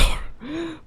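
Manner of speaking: short, quiet, high giggle; a little embarrassed laugh; soft giggle. Real, not acted. Caster laughs at lost voice.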